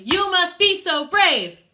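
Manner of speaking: full-voice fortissimo yell; fortissimo shout. Shown as a demonstration. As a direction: top of the crescendo, yell it. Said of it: "get louder and louder with every line".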